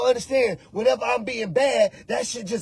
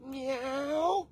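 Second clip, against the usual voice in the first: dog meowing; 3 semitones higher, 12 dB quieter than usual. Pretend. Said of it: "heard a dog go".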